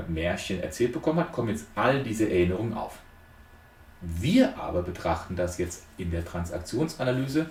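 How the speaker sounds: ordinary talk, nothing marked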